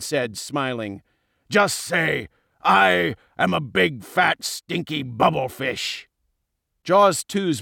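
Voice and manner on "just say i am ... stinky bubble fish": bully voice, relishing each word; smug, taunting villain; taunting villain king. A narrator's reading. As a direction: dictate it mockingly to a beaten victim. Villain dictates humiliating line.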